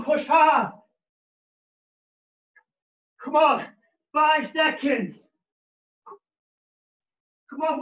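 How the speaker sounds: high, loud coach yelling; shouting encouragement. Real, not acted. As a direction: fire them up for the last sprint. Final sprint, coach yelling encouragement.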